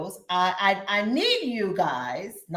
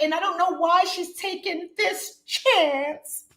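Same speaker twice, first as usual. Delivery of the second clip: put-on higher, slow, fretful older woman voice; imitating mother-in-law; 9 semitones higher, 4 dB louder, slower than usual. Vlogger acting out her worried mother-in-law: raised, slow fretful voice. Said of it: "I have to say it like that".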